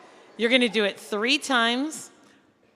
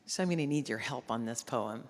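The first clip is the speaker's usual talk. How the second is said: ordinary delivery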